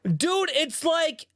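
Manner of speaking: slow, high-pitched fed-up rant; exasperated high whine. Real, not acted. Ranting about annoying anime voices.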